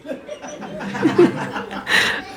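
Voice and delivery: soft breathy chuckle; real laugh at his own punchline; quiet, helpless laughing; amused laugh. Real, not acted.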